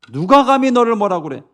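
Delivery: high, loud defence; acted fatherly outrage; protective indignation. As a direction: challenge anyone who dares insult your child. Voices God defending His child.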